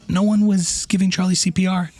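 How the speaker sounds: ordinary delivery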